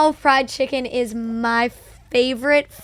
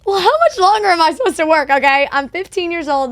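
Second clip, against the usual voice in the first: high, loud and fast, a flustered protest; indignant teen pushback; 6 semitones higher, 6 dB louder than usual. Retold or imitated. Fifteen-year-old arguing with her Chick-fil-A bosses, high, fast indignant protest. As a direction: push back at the boss like an outraged fifteen-year-old.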